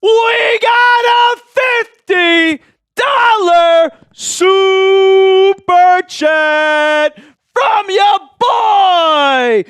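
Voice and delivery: very slow, high, shouted announcement; hype shout. Real, not acted. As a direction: announce it like an arena MC. Hypes a fifty dollar super chat.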